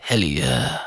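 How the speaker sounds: low, soft, breathy perfume-ad whisper; deep, hushed aftershave-commercial voice. Pretend. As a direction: purr the name low like a fragrance advert. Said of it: "Maybe you have to do it in a weird voice".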